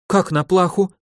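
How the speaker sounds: high, anxious lady's voice; worried lady. A narrator's reading. Lady fears the prisoner will be executed, high and anxious. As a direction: fret for his life. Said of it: "волновалась леди".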